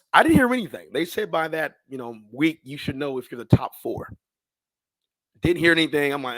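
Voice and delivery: usual speaking voice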